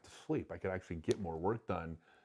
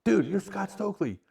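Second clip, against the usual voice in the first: high, loud excited shout; excited recognition; 12 semitones higher, 8 dB louder than usual. Retold or imitated. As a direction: blurt it out like a starstruck fan. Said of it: "Bear goes".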